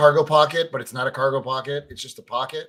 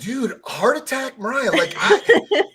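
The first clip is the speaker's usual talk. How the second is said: laughing exasperation; 11 semitones higher, 6 dB louder than usual. Real, not acted. Laughing about stressful game.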